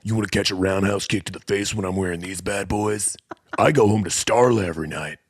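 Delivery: lightly voiced cocky sensei; Rex Kwon Do impression. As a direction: brag like a self-important dojo instructor. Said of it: "You sound like Rex Kwon Do".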